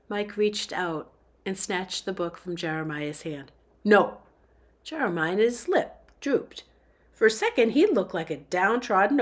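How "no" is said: snapping. Acted. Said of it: "Feeling bad for snapping at Jeremiah".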